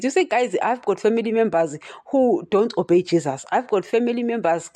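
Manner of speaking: ordinary talk, nothing marked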